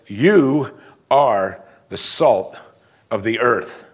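YOU hit loud and drawn out, then slow; weighty, deliberate quote with YOU stressed; double emphatic. Retold or imitated. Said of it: "word they're gonna emphasize as the first word".

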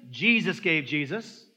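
ordinary talk

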